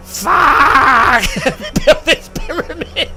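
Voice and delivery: high loud mock curse; mock shout. Builders cursing at yet another pyramid, loud mock shout. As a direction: blurt it out in comic dismay.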